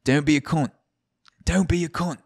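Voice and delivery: high-pitched bad Aussie accent; terrible put-on accent. Shown as a demonstration. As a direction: say it in a hammy Australian accent. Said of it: "whatever terrible accent you appropriate".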